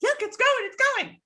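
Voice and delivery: very high mock-boy cry; boys calling out. Boys watching the basket float, high-pitched calling out.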